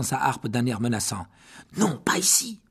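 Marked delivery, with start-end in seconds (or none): 1.8-2.6 urgent whispered plea; whispered protest. Acted. Harry pleading with the dwarf, urgent whisper. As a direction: beg him not here, under your breath.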